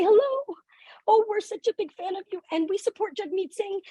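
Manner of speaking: very high, sing-song gushing greeting; gushing parents. Retold or imitated. Parents greeting the Sikh cab driver as big fans, gushing. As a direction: mimic overexcited parents in a high, thin voice.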